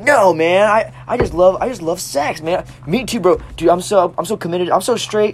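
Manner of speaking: high hammed-up bro voice; mock bro. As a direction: overact the straight-guy protest. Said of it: "He's like".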